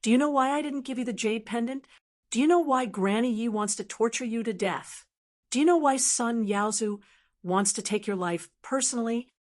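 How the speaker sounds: plain reading voice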